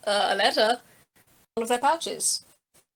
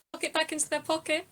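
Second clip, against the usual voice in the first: ordinary talk, nothing marked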